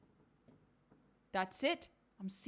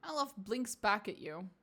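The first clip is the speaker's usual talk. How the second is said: ordinary talk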